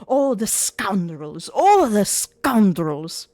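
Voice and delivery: mock outraged yelling. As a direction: bellow it out from behind a door. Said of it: "hear her yelling".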